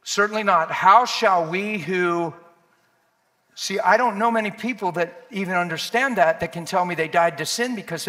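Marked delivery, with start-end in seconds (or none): 0.0-2.3 "Certainly not" louder and firm, then a drawn-out "How shall we who"; emphatic. Shown as a demonstration. Preacher reading Romans to the congregation, emphatic. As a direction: read it out with loud, deliberate weight.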